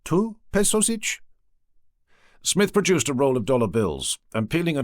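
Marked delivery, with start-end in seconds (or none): 0.0-1.2 slow, high, tentative haggling question; hopeful. A narrator's reading. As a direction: drop the price, unsure now. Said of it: "dropped both smile and the price".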